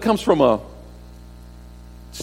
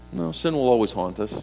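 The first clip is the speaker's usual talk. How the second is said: ordinary delivery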